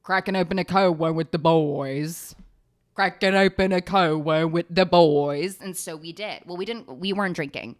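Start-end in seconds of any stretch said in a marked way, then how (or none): 0.0-2.4 a hint of a bro toast; lightly voiced frat guy celebrating; mock bro voice. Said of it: "I was like". 2.9-5.6 the bro toast yelled louder; loud bro cheer. Retold or imitated. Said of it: "I was like".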